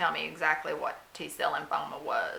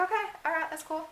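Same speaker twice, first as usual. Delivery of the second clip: oblivious; 9 semitones higher, faster than usual. Retold or imitated. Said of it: "I was oblivious".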